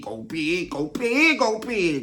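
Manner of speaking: hyped repeat. Acted. Emphatic repeated description.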